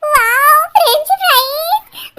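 high, loud, drawn-out delight; excited wow. Acted. Friend spots the French fries, excited high squeal. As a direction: exclaim high, stretching the words.

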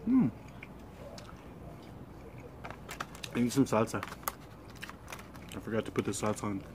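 chewing while talking; talking between bites; mouth full. Real, not acted. Seen 0: bites taco at -1.0, cheeks full, chewing through the labelled span.

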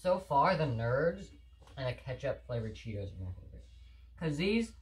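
eating a cookie; talking while chewing. Real, not acted. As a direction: mouth partly full. Seen 1: hoodie speaker holds cookie at lips while talking, likely chewing.

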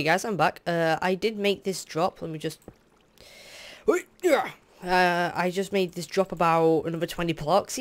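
ordinary talk

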